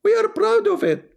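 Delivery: very high squeaky mock pride; mock proud voice. Streamer mocking the caller's side, squeaky mock pride.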